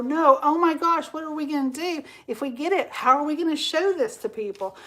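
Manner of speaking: playing a friend who panics over a late order; mock panic. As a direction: fret aloud like it's a disaster.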